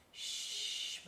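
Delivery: soft unvoiced hush; whispered sleepy shh; whispered shush. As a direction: hush him half asleep. Sleeping mother hushing.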